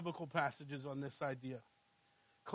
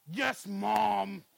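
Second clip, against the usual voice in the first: loud snarling yes mom; snarling toddler; 7 dB louder than usual. Retold or imitated. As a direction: bark it defiantly like a pit bull. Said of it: "snarled more than a pit bull".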